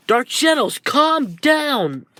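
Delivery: high, urgent cry; desperate plea. Acted. Tokoyami begs Dark Shadow to calm, desperate plea. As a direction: plead at the top of the range.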